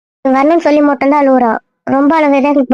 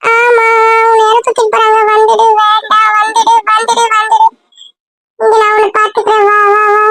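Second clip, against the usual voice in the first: high, wailing plea; crying over a dream; 7 semitones higher than usual. Acted. Child scared she'll be carried off, pleading in tears. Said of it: "கனவுக்குலாம் யாராவது அழுவாங்களா?".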